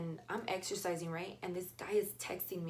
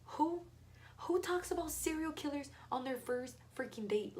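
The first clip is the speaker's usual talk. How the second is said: outraged disbelief; 8 semitones higher than usual. Real, not acted. Outraged at date's serial killer talk.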